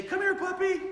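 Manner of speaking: high, sing-song call to a puppy. Retold or imitated. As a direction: coax the puppy over in a high voice. Owner calling the new puppy.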